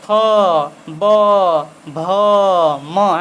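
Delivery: फ, ब, भ, म said clearly, one by one, with the lips closing; lips-together demo. Shown as a demonstration. Teacher showing students the lips closing on फ, ब, भ, म. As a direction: show how the lips meet on फ, ब, भ, म. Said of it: "बिना ओठ को सटा आप इसे नहीं बोल पाएंगे".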